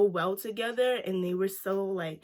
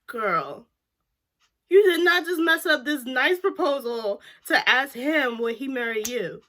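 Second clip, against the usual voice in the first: higher, louder laughing sass; laughing girl-you-did-not attitude; sassy disbelief; 5 semitones higher, 6 dB louder than usual. Retold or imitated. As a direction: scold her like a friend. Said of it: "I was like".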